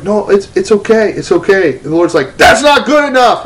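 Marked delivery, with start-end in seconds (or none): 0.0-1.8 timid reassurance. Retold or imitated. Hurt woman brushing off the apology. 2.4-3.4 high, forceful retort in God's role; stern rejection. Retold or imitated. God rejects the apology, acted.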